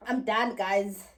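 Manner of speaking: ordinary talk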